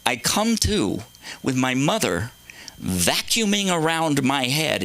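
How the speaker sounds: normal talking voice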